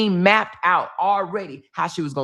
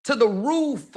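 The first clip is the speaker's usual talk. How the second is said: TO THE ROOF pitched up high, falling on ROOF; emphatic repeat; 5 semitones higher than usual. Real, not acted. Mother boasting of daughter's confidence, emphatic high repeat. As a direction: lift "To the roof" higher for emphasis.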